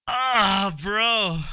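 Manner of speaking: high, loud groaning exclamation; exasperated disbelief. Real, not acted. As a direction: cry out high in dismay at what turned up.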